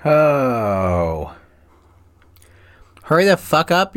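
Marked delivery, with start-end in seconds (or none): 3.0-4.0 impatient yell. Real, not acted. Impatient at the others.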